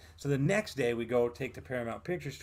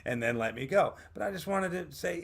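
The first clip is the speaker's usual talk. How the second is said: normal talking voice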